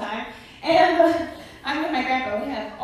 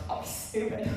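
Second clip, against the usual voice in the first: quiet aside; 3 semitones lower, 8 dB quieter than usual. Retold or imitated. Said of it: "I look".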